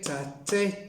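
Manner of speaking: ordinary talk, nothing marked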